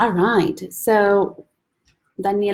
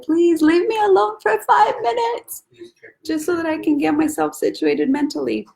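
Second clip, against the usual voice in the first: mock plea; 10 semitones higher than usual. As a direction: plead theatrically, stretching out the words. Retells her own plea for time alone.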